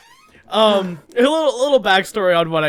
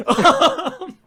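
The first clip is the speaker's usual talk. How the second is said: laughing; 12 semitones higher, 5 dB louder than usual. Real, not acted. Laughing at own joke.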